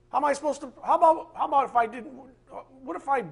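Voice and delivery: high, rising heated protest; getting heated. Real, not acted. Preacher getting mad at the lie, voice climbing. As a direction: voice climbs as he gets worked up. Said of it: "'cause I get mad".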